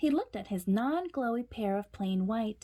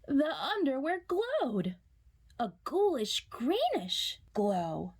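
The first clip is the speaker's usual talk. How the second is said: spooky reveal; 5 semitones higher, slower than usual. A narrator's reading.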